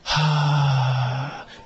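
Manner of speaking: long, even, soft "haa" that fades out; soft relaxed exhale tone. Shown as a demonstration. Teacher repeating the relaxed breath for the class. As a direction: float the breath out on a gentle tone. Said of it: "もう一回".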